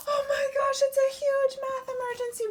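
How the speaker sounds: very high, loud mock panic. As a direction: play the alarm huge and fast. Huge math emergency.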